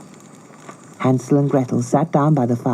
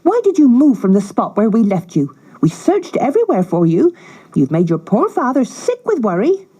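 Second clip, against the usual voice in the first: scolding stepmother; 6 semitones higher than usual. Acted. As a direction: feign worry while berating. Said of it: "she cried".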